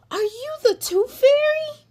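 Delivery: high, loud wide-eyed question; amazed child. A narrator's reading. Aria meeting a fairy on her bike, amazed. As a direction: ask it breathless with wonder.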